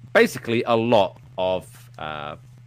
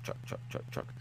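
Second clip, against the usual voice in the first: whispered rhythmic engine chug; truck impression; 17 dB quieter than usual. Shown as a demonstration. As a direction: chug the word softly, over and over. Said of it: "again, truck".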